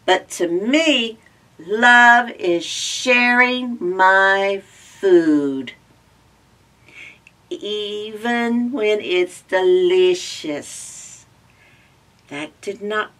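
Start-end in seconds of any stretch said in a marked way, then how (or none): 0.0-11.2 loud, very slow savoring crow. Acted. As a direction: draw it out like a greedy crow. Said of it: "said the crow".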